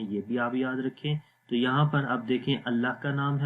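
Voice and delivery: ordinary delivery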